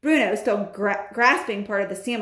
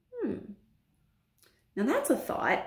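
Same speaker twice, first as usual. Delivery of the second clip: soft, musing hum, then interest; quietly tempted by the idea; perking up a bit; 2 semitones higher, 6 dB quieter than usual. Acted. Bruno hearing of all the larder food, softly perking up. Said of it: "perking up a bit".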